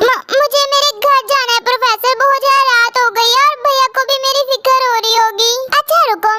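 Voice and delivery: normal talking voice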